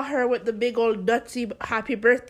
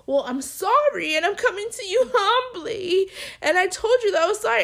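high, fast imitation of a pleading apology; mocking the coworker's defensive apology; 9 semitones higher, 5 dB louder than usual. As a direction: ham up her whining apology.

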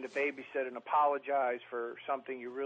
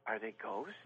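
patronizing; 8 semitones lower, 10 dB quieter than usual. Retold or imitated. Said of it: "she starts patronizing me".

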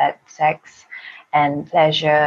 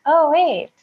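much higher, mock-surprised discovery; acted dawning realization; 11 semitones higher than usual. Woman replaying her eye-opening moment, surprised realization. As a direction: lift the pitch high as the penny drops. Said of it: "the moment when my eyes open and be like".

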